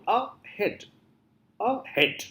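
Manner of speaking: "A head" split in two, rising on head; syllable by syllable. Shown as a demonstration. Teacher showing the mouth changing shape, syllable by syllable. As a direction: separate "A" from "head". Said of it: "you are changing shape of the mouth".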